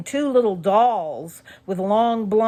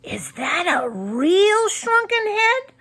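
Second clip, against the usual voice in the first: much higher, slow amazed question; a boy stunned by what he sees; awed; 13 semitones higher, 4 dB louder than usual. A narrator's reading. Boy asks about the shrunken head, awed and higher.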